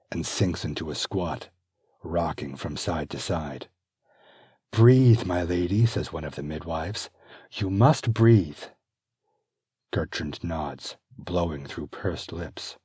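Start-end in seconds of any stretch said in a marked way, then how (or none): 4.7-8.6 urgent birthing coach; coaching midwife. Acted. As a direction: coach her through the pain.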